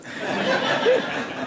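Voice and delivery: light airy laugh at her own joke; breathy laugh. Real, not acted. Audience laughs at lock-doors joke.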